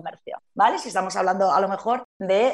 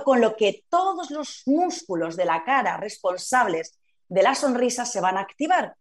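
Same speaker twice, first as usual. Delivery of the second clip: usual speaking voice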